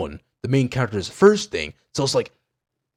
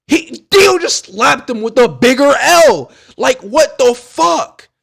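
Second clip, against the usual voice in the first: much louder, high-pitched exclamation; hyped outburst; 12 semitones higher, 7 dB louder, slower than usual. Real, not acted. Fan recounting Dio's surprise blow, loud and hyped. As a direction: yell it in amazed disbelief.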